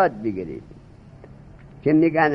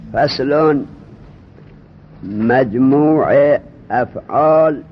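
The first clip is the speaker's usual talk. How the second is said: reciting the textbook; 4 semitones lower, much slower than usual. Acted. Teacher reading a new grammar chapter to students, slow and emphatic. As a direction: intone each term so students can follow.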